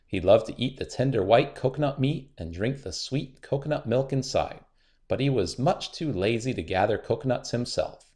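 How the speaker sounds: ordinary delivery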